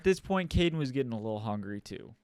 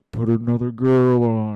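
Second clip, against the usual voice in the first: loud demand; 2 semitones lower, 11 dB louder than usual. Retold or imitated. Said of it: "I was like".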